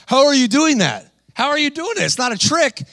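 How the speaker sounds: high, astonished questions from the fighters; amazed fighter. Retold or imitated. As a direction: marvel like you can't believe it. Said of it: "they're freaked out when you do this".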